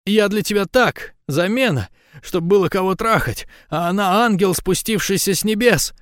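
high, indignant woman's tirade; bitter, high-pitched outburst; venting anger. A narrator's reading. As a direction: spill out the resentment in a high voice. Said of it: "позволяя выплеснуть всю злость".

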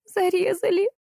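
high, thin lament held on one note; moaning wail. A narrator's reading. Salesgirl moans from under the counter, high wail. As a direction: moan as if being slaughtered. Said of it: "стонала из-под прилавка девушка".

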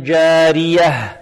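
جارية slow and chanted, the final ه breathed out clearly. Shown as a demonstration. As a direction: chant جارية and sound the ه at the end. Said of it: "فيها عين جارية".

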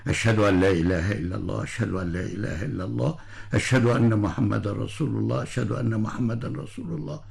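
hushed private recital of the shahada; said silently. Shown as a demonstration. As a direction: murmur it to yourself. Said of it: "تقال صمتا، يعني سرا".

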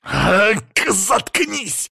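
high, explosive yell; strained, high-pitched outburst; furious shout. A narrator's reading. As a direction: burst out to shut him up. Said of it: "крикнул Антон".

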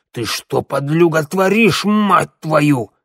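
furious creature climbing in pitch and volume; rising thuggish fury; outraged yell. A narrator's reading. Creature attacks Teodor, outraged.

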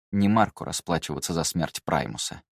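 ordinary delivery, nothing marked

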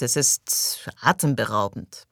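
slow, overdone show of being impressed; mock enthusiasm. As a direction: lay the praise on thick, slowly. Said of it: "„Schon besser".

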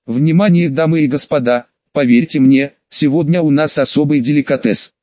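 ordinary delivery

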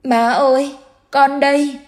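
put-on high, small, choked-up child's voice; a dead boy sobbing as he calls his mother. A narrator's reading. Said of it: "Nó ấm ức khóc".

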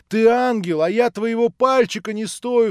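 high, loud outburst of adoration; rapturous, remorseful cry. A narrator's reading. Alyosha adoring Natasha, unworthy of her, rapturous cry. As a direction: exclaim in rapture and repentance. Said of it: "вскричал Алёша с восторгом и с раскаянием".

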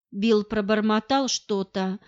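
usual speaking voice

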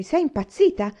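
Jerry shocked at his sister; incredulous. Acted. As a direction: shout it in disbelief.

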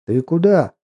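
loud, high blurted question. Acted. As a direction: let it burst out unchecked. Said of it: "вырвалось у меня".

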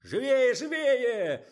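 very high, loud hurry-up shout; urging shout. A narrator's reading. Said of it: "подгонял их Николай".

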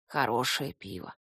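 low, soft creaky stump voice; creaky creature voice. Acted. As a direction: creak it out like a connoisseur. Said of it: "проскрипел он с видом знатока". (скрипуче, со знанием дела)

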